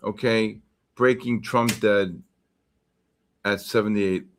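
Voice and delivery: usual speaking voice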